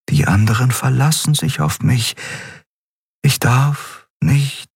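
voiced plea drifting into sleep; drowsy insistence. A narrator's reading. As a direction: drag the words out as sleep takes her. Xea fighting sleep, last words.

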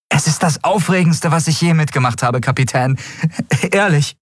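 bursting with joy at his first day; thrilled cabin boy. Acted. Miko tells the captain it is the best day ever, thrilled.

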